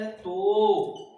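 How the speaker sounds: तो drawn out long and pushed hard; stress on तो. Shown as a demonstration. Teacher demonstrating the dual form to students, long emphatic तो. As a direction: hold the vowel of तो with a swelling emphasis. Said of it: "मैं तो का उच्चारण कर रहा हूं, मतलब फिर पराघात हो रहा है".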